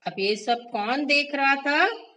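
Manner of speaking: ordinary delivery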